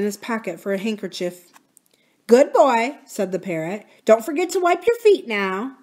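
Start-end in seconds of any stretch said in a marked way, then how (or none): 2.3-3.0 high, loud praise; parrot mimicking a woman. A narrator's reading. Parrot addressing the uncle as his wife would, mimicking. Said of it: "imitated Aunt Polly's voice very well". 4.0-5.8 high, loud nagging parrot; Kiki. A narrator's reading. Parrot ordering Uncle Jocelyn about, mimicking his wife. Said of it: "imitated Aunt Polly's voice very well".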